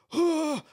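high, loud "aah" of panic; mock terrified scream. Wrestler admitting nerves before going on, mock terrified scream. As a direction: let out a short mock scream of stage fright. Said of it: "don't think I'm not terrified".

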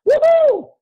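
very high, loud celebratory whoop; mocking the rich man's victory yell; gleeful whoop. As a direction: whoop like you just hit the jackpot. Billionaire whooping about sweeping up assets.

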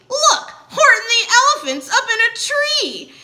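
high, loud jeering holler; gleeful shout. A narrator's reading. Friends spotting Horton up a tree, gleeful shout. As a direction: shout it out with mocking glee. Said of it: "they shouted with glee".

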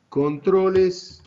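drawn-out words with long pauses; slow, deliberate dictation. Real, not acted. Teacher introducing a key term to students, slow and deliberate. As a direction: set out the term word by word, slowly.

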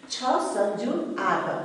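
usual speaking voice

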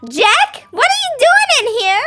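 high, loud, caught off guard; startled. Acted. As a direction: react in alarm at an intruder. Caught girlfriend startled by Jack.

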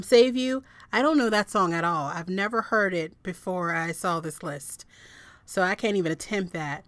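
ordinary delivery

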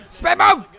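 high, loud shout; excited call. Real, not acted. Gamer shouting his spin move mid-run, excited. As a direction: bark out the move as you hit it.

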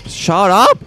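high startled yell; shocked shout. Real, not acted. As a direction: blurt it out, shocked. Startled by a discovery.

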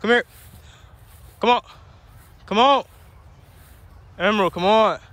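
high, loud call to the dog. Real, not acted. Owner calling her wandering puppy back, high loud call.

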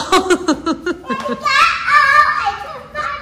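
very high-pitched squealing giggle; laughing. Real, not acted. Mother told not to laugh at child, helpless giggling. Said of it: "đừng có cười con".

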